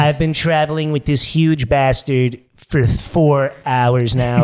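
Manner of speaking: slow presidential impression; Donald Trump. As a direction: speak with a drawn-out politician's bombast. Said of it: "I got Trump and Fat Bastard".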